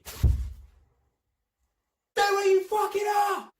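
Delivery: loud, high, drawn-out yell; shouted police order. Retold or imitated. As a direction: imitate an officer bellowing at a suspect. Acts policeman grabbing and ordering him.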